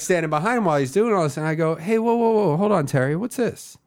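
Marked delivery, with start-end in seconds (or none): 1.8-3.8 mock surprise. Feigning shock on stage.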